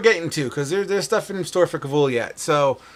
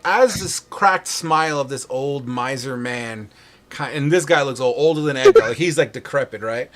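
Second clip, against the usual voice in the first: ordinary delivery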